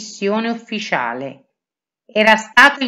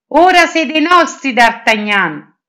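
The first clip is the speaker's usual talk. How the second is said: high, louder, pleased cry; satisfied exclamation; 6 semitones higher, 5 dB louder than usual. A narrator's reading. Porthos cheers his friend's promotion, high and happy exclamation. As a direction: call it out, delighted, slowing on the name. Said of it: "esclamò Porthos soddisfatto".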